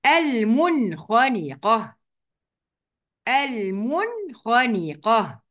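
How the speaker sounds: المنخنقة slow, noon in المن sounded clearly; slow drawn-out المنخنقة, noon plain and clear; clear izhar. Shown as a demonstration. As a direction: recite المنخنقة slowly, no nasal hiding. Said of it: "ناخد مثال كمان".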